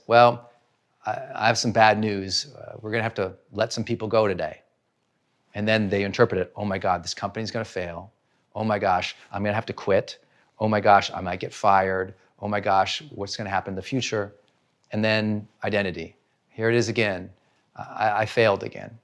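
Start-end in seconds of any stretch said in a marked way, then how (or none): 0.1-4.6 boss bringing bad news. Boss announcing layoffs at work. 6.5-14.3 anxious inner voice. Retold or imitated. Employee panicking over layoffs.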